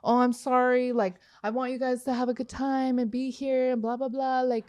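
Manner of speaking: imitating the doorman's sorry excuses, softly; mock apologetic doorman. Narrator mocks doorman apologizing to the band, lightly fawning. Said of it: "trying to apologize and be like".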